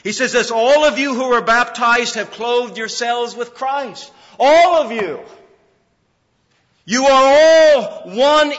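thundering sermon climax; preaching at full force. Acted. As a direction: build to a shout of triumph.

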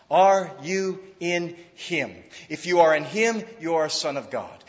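ordinary delivery

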